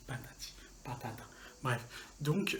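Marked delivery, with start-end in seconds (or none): 0.1-1.2 chuchoté. Shown as a demonstration. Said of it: "je dis beaucoup patati patata".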